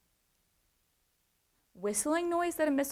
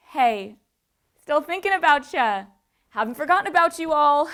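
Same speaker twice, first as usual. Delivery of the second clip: cheery, loud, higher-pitched mock reassurance; mock friendly update; 5 semitones higher, 9 dB louder than usual. Imagined immigration office writing to applicants, mock friendly. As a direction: play a chummy, upbeat check-in, loudly.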